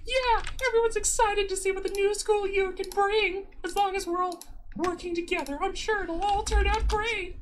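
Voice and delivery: very high bubbly schoolgirl voice; sugary cheerful high school girl; Trixie Starbright. Acted. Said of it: "do I really have to do it for the rest of the video".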